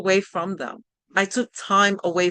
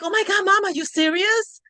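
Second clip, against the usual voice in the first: much higher, thrilled disbelief; excited kids; 12 semitones higher than usual. Retold or imitated. Kids hearing Mom lost her job, thrilled and high. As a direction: kids squealing at the news. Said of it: "They were like".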